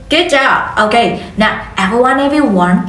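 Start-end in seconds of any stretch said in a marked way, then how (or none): none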